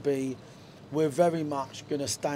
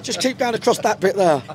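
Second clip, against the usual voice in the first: loud call across the room, higher; 5 semitones higher, 9 dB louder than usual. Real, not acted. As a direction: shout a direction to someone nearby. Calling out to someone nearby.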